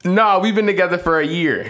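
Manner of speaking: high-pitched insistent correction; defensive protest. Real, not acted. Man correcting his co-parent about his relationship, defensive.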